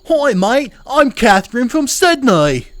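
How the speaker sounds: loud, high-pitched hammed-up Aussie greeting; over-the-top Australian character voice; bad Australian accent. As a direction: belt out a cartoonish Australian accent, stretching the words. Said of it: "I don't do a good Australian accent".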